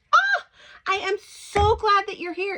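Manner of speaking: high, loud, over-the-top delighted welcome; gushing greeting. Retold or imitated. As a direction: gush at him like a long-lost friend. Stranger greets son at breakfast.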